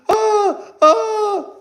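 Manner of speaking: high fake wail, cracking; hammed-up high wail; mock wailing. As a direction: squeal like a crybaby. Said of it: "That's how you guys sound".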